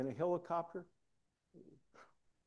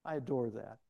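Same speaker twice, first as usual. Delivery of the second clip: usual speaking voice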